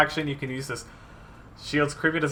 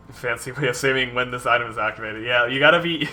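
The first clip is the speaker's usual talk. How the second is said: normal talking voice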